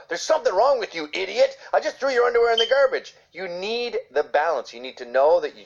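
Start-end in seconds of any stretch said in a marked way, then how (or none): 0.1-3.1 quick, higher harsh dad scolding; mock angry dad. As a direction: lightly play the fed-up bad cop. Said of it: "And you can't have all the".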